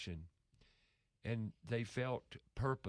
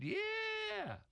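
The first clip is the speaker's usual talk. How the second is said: much higher, beaming yeah; happy worker's yes. Retold or imitated. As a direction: imitate a delighted worker answering. Voicing the happy lady worker.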